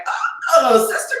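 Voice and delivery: light up at a familiar voice; delighted greeting. Retold or imitated. Hearing English abroad, delighted high hello.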